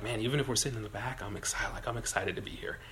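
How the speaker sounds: soft, fast, low-voiced thrill at the back; hushed excitement. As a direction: keep it low, buzzing with excitement. Concertgoer hushed at back seats.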